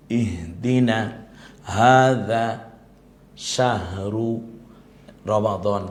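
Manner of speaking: ইহদিনা, হাযা, শাহরু drawn out very slowly; slow Quranic words. Shown as a demonstration. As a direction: recite each Quranic word carefully. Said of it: "যেটা কোরআনে আল্লাহ বলেছে". (ধীরে কোরআনের শব্দ উচ্চারণ)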